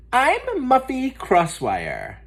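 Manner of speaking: put-on high, slow snooty girl voice; lofty high-pitched introduction; stuck-up girl. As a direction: announce yourself like a spoiled rich kid. Muffy introduces herself, stuck-up.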